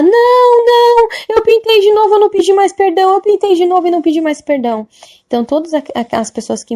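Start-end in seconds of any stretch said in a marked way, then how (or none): 0.0-4.9 high, loud wail that fades as she goes; a panicked old lady admitting it; distraught old woman. Retold or imitated. Old woman admitting to the angel, wailing then fading.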